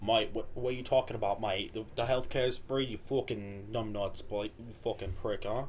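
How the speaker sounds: low, quiet mock British grumble; mock British accent. Host mocking Brits calling people numbnuts, fake British accent. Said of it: "mock the accent".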